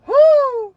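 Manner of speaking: shrieking woo of laughter; high loud whoop. Real, not acted. After retelling the boss, a high loud whooping laugh. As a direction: let out a high screaming laugh.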